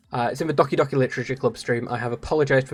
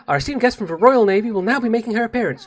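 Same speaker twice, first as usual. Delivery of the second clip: lightly voiced, higher-pitched event host; cat-girl announcer; 6 semitones higher than usual. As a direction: introduce a guest with a little flourish.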